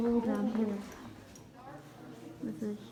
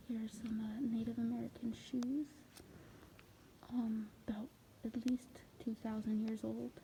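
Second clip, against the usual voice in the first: hushed museum whisper; 7 dB quieter than usual. Real, not acted. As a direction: keep it to a whisper by the display. Whispering near exhibit.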